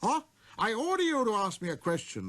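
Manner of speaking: high, loud regal summons; proud king summoning a visitor; king's command. A narrator's reading. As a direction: call him closer with pride. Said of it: "the king hastened to assure him".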